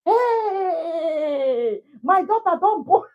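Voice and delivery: father's long, high celebratory cry; jubilant shout. Retold or imitated. Father announcing his daughter's delivery to the village, jubilant shout. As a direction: holler the news high and loud, stretching the first word.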